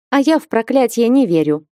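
a touch of youthful nonchalance; carefree. A narrator's reading. Young woman dismisses witch's warning of curses, carefree. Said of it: "легкомысленно ответила молодая совсем девушка".

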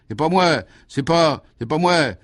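high, loud, insistent protest; desperate denial. A narrator's reading. Pressed suspect denies it to the commissioner, desperately insistent.

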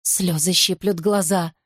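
usual speaking voice

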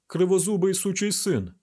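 usual speaking voice

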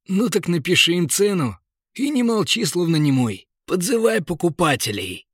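jolly merchant. Acted.

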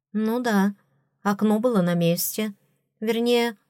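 plain reading voice

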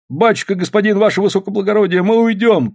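high, anxious appeal to authority; frightened pleading. A narrator's reading. Frightened miners to the official, high pleading. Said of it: "уже пугаясь сейчас того".

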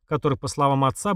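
usual speaking voice

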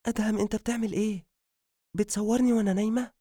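high, quick, startled questioning; sit up fast and protest in a high voice; rapid, high-pitched surprise on waking; startled young wife. A narrator's reading. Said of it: "فنهضت بسرعة وهي تقول".

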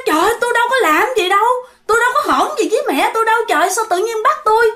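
high, fast pleading protest; imitating a panicked woman protesting her innocence; acted indignant protest. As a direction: rattle out a high-pitched denial. Acted protest against being taken away.

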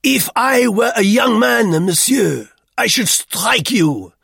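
put-on loud, high old man's threat; rally and threaten at full voice; ferocious. A narrator's reading. Duclos threatens Roux, ferociously. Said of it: "struck his beard forward ferociously".

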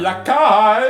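loud summoning call up high. Acted. Pompous master shouting for his lackey. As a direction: bellow for the servant across the house.

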